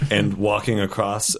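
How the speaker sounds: normal talking voice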